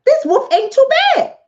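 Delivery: high, pleased mock women's voice; high-voiced sheep won over by the wolf; swayed women.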